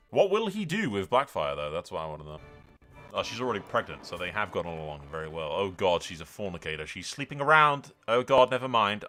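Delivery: ordinary talk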